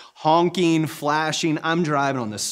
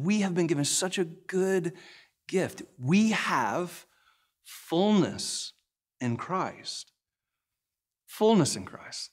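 preaching emphasis; 3 semitones higher, 3 dB quieter, slower than usual. Acted.

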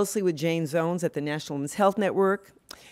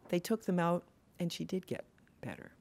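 ordinary talk, nothing marked

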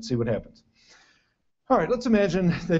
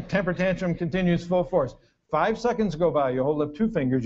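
normal talking voice

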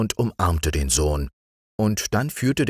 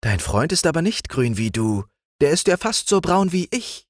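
higher, chirpy princess voice; twittering nightingale; 13 semitones higher than usual. A narrator's reading. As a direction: chirp it like a little bird. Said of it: "zwitscherte sie".

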